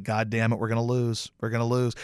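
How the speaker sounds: low, soft despairing groan; resigned, already beaten. Retold or imitated. Dreading the Foles drive, low and despairing. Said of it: "I'm like".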